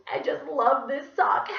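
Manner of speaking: high, slow, loud and tearful; sobbing. A narrator's reading. Teacher sobbing over a beautiful sock. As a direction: cry out your love for it. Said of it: "she started to sob".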